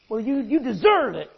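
mock angry retort. Balaam telling the donkey it deserved the beating, huffy and loud. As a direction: snap it back defensively. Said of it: "He says".

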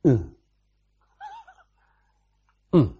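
passing grunt. Shown as a demonstration. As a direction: give an offhand grunt of acknowledgement. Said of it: "gave God a passing grunt".

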